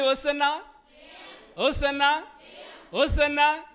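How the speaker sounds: high, very loud rallying shout; shouted acclamation. Shown as a demonstration. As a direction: lead the crowd, each cry ringing out and lifting at the end. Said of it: "ఇప్పుడే అనండి". (గట్టిగా అరుస్తూ జయధ్వని)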